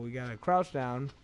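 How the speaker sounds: ordinary talk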